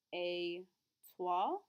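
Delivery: Et toi? with the voice rising on toi; rising question. Shown as a demonstration. Teacher models the informal question with a rise. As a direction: lift the voice on toi at the end. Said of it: "make sure that voice goes up at the end".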